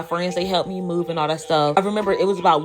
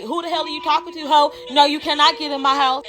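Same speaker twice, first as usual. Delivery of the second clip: higher-pitched indignant refusal; sassy, acted-out anger; mad retort; 8 semitones higher than usual. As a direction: tell her off, retold. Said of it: "And I'm mad".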